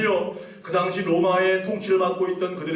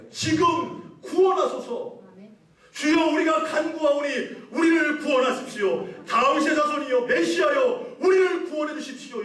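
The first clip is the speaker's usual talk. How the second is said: high, pleading cry for rescue; high-pitched crowd plea; 8 semitones higher than usual. Acted. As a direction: call up to a saviour in a raised voice. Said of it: "이렇게 외치고 있는 거예요".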